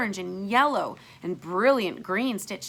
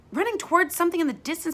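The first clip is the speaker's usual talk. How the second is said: ordinary delivery, nothing marked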